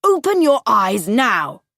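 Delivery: father ordering his frightened son, loud and stern; stern shout. Acted. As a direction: bark it at the scared boy. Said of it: "shouted his father".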